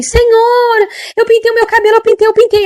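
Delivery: high, loud wailing plea; imitated desperate confession. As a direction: cry out like a frantic old woman confessing. Reenacts old woman's panicked confession. (imitando confissão desesperada, aos gritos)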